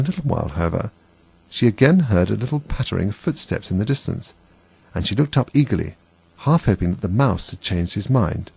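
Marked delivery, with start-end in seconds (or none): none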